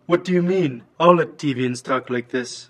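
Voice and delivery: stilted robotic monotone. Acted. Villain defending his accent to therapist, robot voice. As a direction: talk like a robot, every word even. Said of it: "Latvians speak with a bleeding robot voice".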